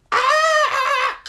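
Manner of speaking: very high, loud scream; mock falling scream. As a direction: scream like toppling into a tree, played for laughs. Wife falls into the tree.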